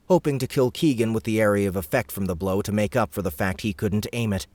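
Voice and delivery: ordinary delivery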